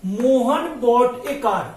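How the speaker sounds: very slow, word by word, as if writing on the board; slow board-writing dictation. Shown as a demonstration. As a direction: spell out Mohan bought a car slowly for the class. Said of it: "example जैसे लिखा हमने".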